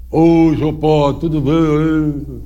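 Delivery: cavalo arrastado, bem lento; voz do cavalo. Retold or imitated. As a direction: fala como cavalo, devagar e esticado. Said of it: "E fazia a voz do cavalo". (padre ventríloquo faz o cavalo responder, bem lento)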